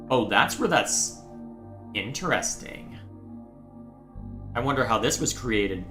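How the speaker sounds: ordinary talk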